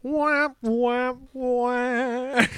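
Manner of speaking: high mocking wah wah; mock crying. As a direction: whine like a crybaby. Mocking crybaby whine.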